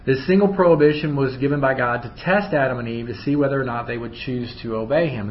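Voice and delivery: ordinary talk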